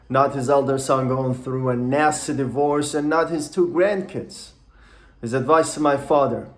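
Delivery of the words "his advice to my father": ordinary delivery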